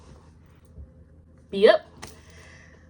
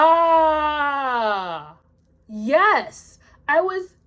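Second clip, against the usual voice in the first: high, loud burst of realization; real, high-pitched excited exclaiming; excited recognition; 7 semitones higher, 6 dB louder, much slower than usual. Real, not acted. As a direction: cry out as it finally clicks. Sudden delighted recognition of a rule.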